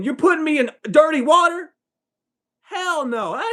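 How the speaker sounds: very high, loud disbelief; incredulous objection. Acted. Objecting to being put in the baptism water, incredulous. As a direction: squeal the question, then refuse up high.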